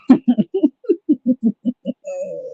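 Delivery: falling giggle; giggling laugh. Real, not acted. Laughs after bit.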